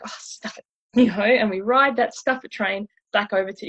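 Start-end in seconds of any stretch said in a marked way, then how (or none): 0.0-0.6 voiceless, hushed exasperation; mock giving up. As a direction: toss it out under the breath. Acted giving-up moment in retelling.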